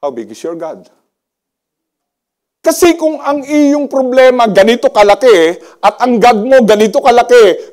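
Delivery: ordinary talk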